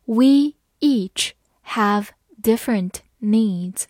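slow, word by word; slow and clear, each word apart; slow reading. Shown as a demonstration. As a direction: read it slowly for learners. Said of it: "好，慢慢来读。".

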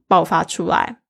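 normal talking voice

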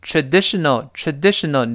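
ordinary talk

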